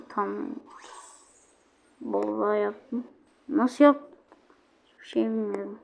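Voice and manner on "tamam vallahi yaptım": ordinary talk, nothing marked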